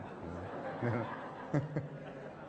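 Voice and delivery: soft low chuckle; quiet amused laugh to himself. Real, not acted. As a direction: let out a small chuckle. Chuckles after the retold invitation.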